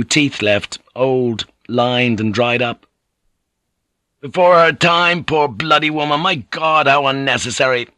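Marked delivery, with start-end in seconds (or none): 4.2-7.9 a man raging at needless death; cried out in rage. A narrator's reading. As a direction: cry it out in fury. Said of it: "he cried out in rage".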